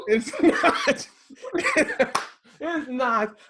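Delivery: high, laughing protest. Real, not acted. Husband admitting calm down is a bad phrase, laughing. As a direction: break up laughing through the words. Laughing at his own mistake.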